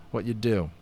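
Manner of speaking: ordinary delivery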